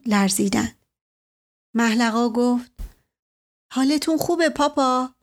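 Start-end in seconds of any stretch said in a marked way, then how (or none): 3.7-5.1 higher daughter's voice, gently concerned; a worried daughter checking on her father; daughter asking. A narrator's reading. Daughter asks her father if he's alright, higher and concerned.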